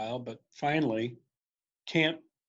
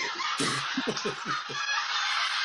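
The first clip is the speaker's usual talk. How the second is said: airy high laugh at his own joke; wheezing laugh after the punchline; 10 semitones higher than usual. Real, not acted. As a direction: breaks into a breathy laugh.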